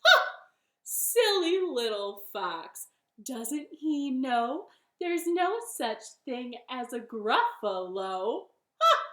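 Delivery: loud, drawn-out gloating; smug bunny. Acted. Bunny mocks fox after fooling him, smug. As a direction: crow it slowly with mock pity. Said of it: "She said".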